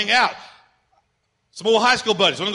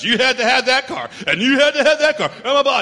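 higher, louder mocking nag; mock whiny demand; 4 semitones higher, 4 dB louder than usual. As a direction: parody the spouse who had to have it.

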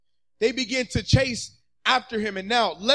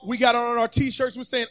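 normal talking voice

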